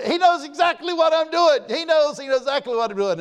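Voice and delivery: high, loud preaching cry building the point; preaching shout. Real, not acted. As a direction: shout it out to the congregation.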